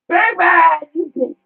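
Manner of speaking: loud crying yell of insults; mock angry shout. Ranting at an absent rival, a hammed-up furious crying yell. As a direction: bellow it like a furious scorned friend.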